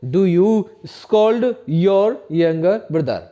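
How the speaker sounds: very slow, every word separate; teacher models an English question very slowly; slow model sentence. Shown as a demonstration. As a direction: dictate it slowly for the class to repeat. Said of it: "क्या बोलेंगे?".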